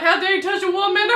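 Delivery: quick, hammed-up gallant line; mock heroic hero. Quoting the rewritten gunman, mock heroic. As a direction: play the chivalrous gunman, tongue in cheek. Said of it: "That line when he's like".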